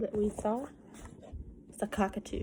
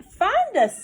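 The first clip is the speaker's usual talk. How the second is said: higher, louder playful call; calling children; 12 semitones higher, 9 dB louder than usual. Acted. Hiding children calling to the seeker, high playful call. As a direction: call it out like kids playing hide and seek.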